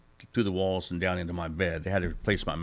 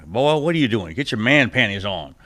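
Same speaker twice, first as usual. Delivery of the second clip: country guy voice; 3 semitones higher, 9 dB louder than usual. Retold or imitated. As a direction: bark it like a rough country buddy. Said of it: "country guy".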